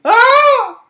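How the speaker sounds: high loud scream; loud shrieking scream. Acted. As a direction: shriek out in fright. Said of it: "People screamed".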